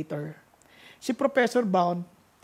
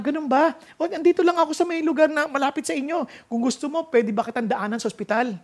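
voicing the professor; 8 semitones higher, 4 dB louder, faster than usual. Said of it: "Ang sabi niya".

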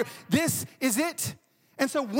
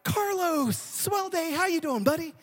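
high-pitched, chipper greeting; imitated cheery neighbor; 7 semitones higher, slower than usual. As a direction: play the unsuspecting friendly neighbor. Cheery neighbor answers the door.

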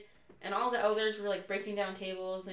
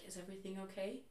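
much softer worried question; quiet concern; 10 dB quieter, faster than usual. Retold or imitated. President quietly asking companion about her, softly. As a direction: ask it softly aside. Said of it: "he looks at Rissa and he's like".

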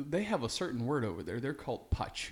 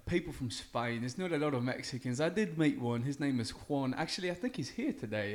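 lightly done cockney bloke, mate-style; put-on British accent; 2 semitones higher, 4 dB louder than usual. Guest on the show, answering in a light mock British accent. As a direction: play a chatty Brit. S0 plays British character Welvin.

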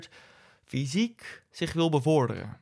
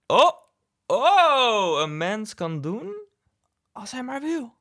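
hammed-up philosopher's revelation, rising "oh"s building high and loud; Leon Battista Alberti; 9 semitones higher, 5 dB louder, slower than usual. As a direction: play a mock eureka moment, swooping up high. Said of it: "toen ene filosoof, Leon Battista Alberti, zoals van".

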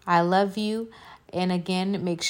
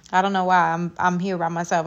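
normal talking voice

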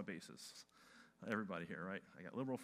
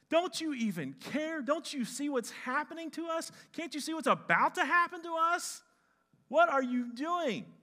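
high, loud, desperate demand; frantic accusation; 11 dB louder than usual. Acted. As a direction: shout at him for sleeping through it. Disciples accuse sleeping Jesus.

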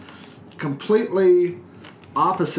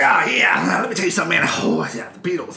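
Charlie Manson impression; 2 semitones higher than usual. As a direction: ham up a jive old cult leader. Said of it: "That's how he sounded too".

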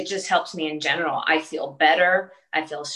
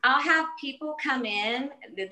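ordinary delivery

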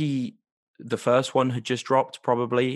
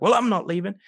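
higher, louder defiant retort; higher defiant Susan; 7 semitones higher, 5 dB louder than usual. Retold or imitated. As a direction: snap back, refusing to budge. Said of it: "she's like".

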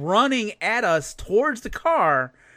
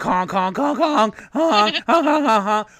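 high, louder mock honks imitating geese; goose honking; 5 semitones higher, 4 dB louder than usual. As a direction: honk like an annoying goose at night. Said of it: "they're just nonstop honk".

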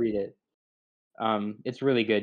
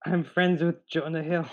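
higher put-on comedian voice; Seth Rogen; 5 semitones higher than usual. Trying once more for a friend, badly faked higher voice. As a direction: attempt a celebrity impression, pitched up. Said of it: "Seth Rogen's voice".

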